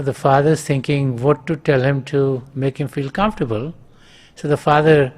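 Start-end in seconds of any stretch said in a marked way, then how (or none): none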